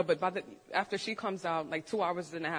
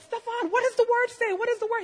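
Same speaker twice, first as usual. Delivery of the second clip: high, loud frantic mock yell; loud, high-pitched retold panic; freaking out; 13 semitones higher, 9 dB louder than usual. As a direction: re-enact panicked demands at your husband. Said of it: "I'm freaking out".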